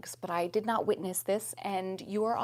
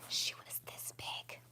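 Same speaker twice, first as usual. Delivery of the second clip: breathy whisper for emphasis; hushed whisper; 13 dB quieter than usual. Real, not acted. Whispering to viewers how huge the spider was. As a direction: whisper it like a secret.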